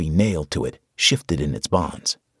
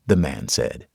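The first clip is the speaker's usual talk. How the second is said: plain reading voice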